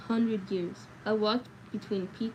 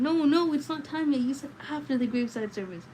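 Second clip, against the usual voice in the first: loud, high silent protest; shouting inside, pleading; inner shout; 6 semitones higher, 5 dB louder than usual. A narrator's reading. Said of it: "Inside, I was shouting".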